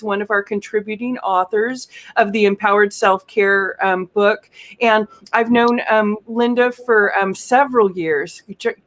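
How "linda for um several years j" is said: usual speaking voice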